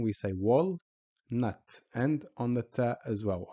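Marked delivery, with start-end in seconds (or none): 0.3-1.6 "wal, nut" with a clear pause after L; stop on the L. Shown as a demonstration. Teacher showing where we stop, split word. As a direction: halt on "wal", then give "nut". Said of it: "we stop on the lam".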